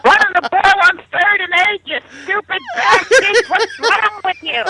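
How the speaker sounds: very high, slow, laughing screech at the team; the wife parroting his football yelling; high-pitched parrot voice. Retold or imitated. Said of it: "comes back in a high-pitched voice".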